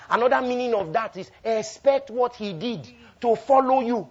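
high, loud, building to a ringing climax; full-force preaching. Real, not acted. Preacher explaining what following him means, full-force preaching. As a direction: proclaim it with mounting force.

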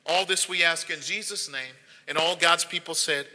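low, soft, earnest prayer voice. Acted. Pastor praying over the online congregation, soft earnest prayer. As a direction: pray quietly over the people, unhurried.

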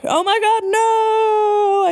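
very high, drawn-out falling cry; mock scream. A narrator's reading. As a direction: wail it as if dropping away. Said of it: "I screamed as I fell down".

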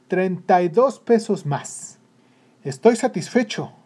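pleased and emphatic; satisfied father. Acted. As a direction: savour it proudly, loud and drawn out. Pleased father repeats his gain.

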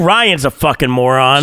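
ordinary delivery

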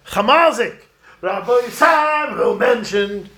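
shouting out a window to someone far; calling out. Pretend. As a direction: call out to someone down the driveway.

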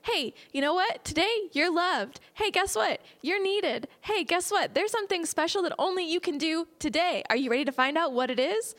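high, upbeat cheerleader calls; hype person. Retold or imitated. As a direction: pump someone up each morning. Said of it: "our own hype person every day that's like".

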